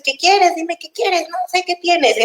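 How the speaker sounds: imitating an angry parent. Angry parent demanding to know why the child cries, imitated. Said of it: "estás enojado y entonces".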